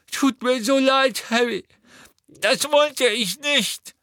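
high, loud, slow pained cry; pained Neville. Acted. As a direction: plead through the pain, drawn out. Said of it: "das Gesicht schmerzerfüllt". (gequält und schmerzerfüllt, hoch und langsam)

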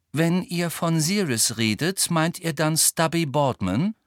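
slow, mild, musing question; dreamy Luna. A narrator's reading. Luna asking about Sirius, slow and dreamy. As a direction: ask it in a leisurely, dreamy way.

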